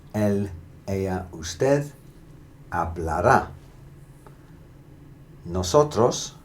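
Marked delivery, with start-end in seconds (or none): 2.7-3.5 hablará with emphasis on the final rá; hablará, landing firmly on rá; final-syllable stress. Shown as a demonstration. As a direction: punch the last syllable of hablará. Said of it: "particular emphasis on the last syllable".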